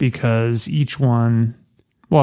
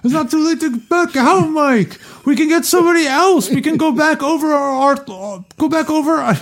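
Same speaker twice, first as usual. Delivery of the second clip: much higher put-on voice for Hagen; silly high voice. Reader trying out a funny high voice for Hagen. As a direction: ham up the reading in a high character voice. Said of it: "Can't read in that voice".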